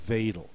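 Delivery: Wedel said veh-del; German Wedel. Shown as a demonstration. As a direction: start Wedel with a V. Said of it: "you call that".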